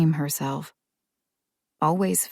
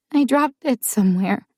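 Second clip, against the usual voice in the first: put-on a sad little girl confessing; child still teary from crying; 7 semitones higher, 3 dB louder than usual. A narrator's reading. Child continues.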